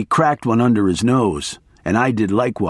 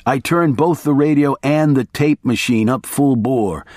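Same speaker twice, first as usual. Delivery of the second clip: ordinary delivery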